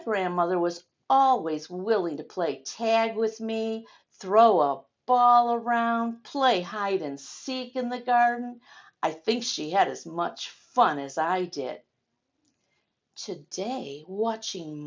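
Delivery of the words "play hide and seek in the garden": ordinary talk, nothing marked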